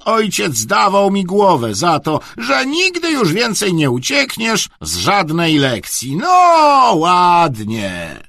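high, loud, slow tirade; angry scolding. A narrator's reading. Headmaster dressing down a truant, scolding loudly. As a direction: build to a loud, drawn-out reproach.

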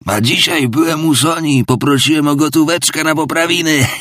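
high, feigned brazen mockery; forced mockery. A narrator's reading. Drunk father mocks himself before Raskolnikov, brazen. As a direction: sneer it out with false cheek. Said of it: "z jakimś udanym kpiarstwem".

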